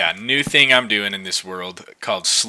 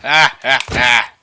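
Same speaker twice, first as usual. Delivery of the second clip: villain laugh; 3 semitones higher, 7 dB louder, slower than usual. Acted. Mock villain laugh at llamas.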